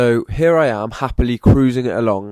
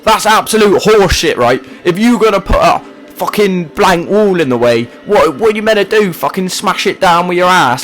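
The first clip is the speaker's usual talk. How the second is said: raised, louder, indignant ranting; angry rant; 7 semitones higher, 6 dB louder than usual. Real, not acted. Gamer ranting about the wall blocking the road, angry. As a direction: vent your fury at the game's designers.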